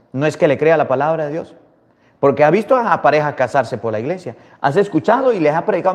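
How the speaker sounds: usual speaking voice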